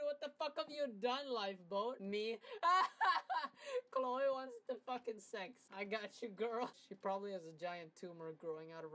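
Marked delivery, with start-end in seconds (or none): 2.4-3.9 loud high cackle; loud high laugh. Real, not acted. Surprised he can type sex, a loud high laugh. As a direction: let out a loud squealing laugh.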